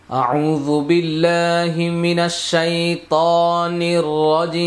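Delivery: slow, drawn-out chanted tilawat; Quran recitation. Acted. Teacher opening the class for students, slow chanted recitation. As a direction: intone it slowly and melodiously.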